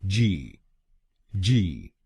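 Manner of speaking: जी said as "jee"; syllable jee. Shown as a demonstration. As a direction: end on जी, jee. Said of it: "हम बोलेंगे जी". (आखिरी शब्दांश जी)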